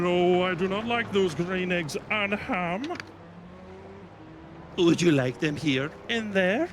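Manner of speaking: hammed-up Dr. Seuss read-aloud; reading to children. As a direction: bounce through the rhyme like a kids' story hour. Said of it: "Doctor Seuss read a book".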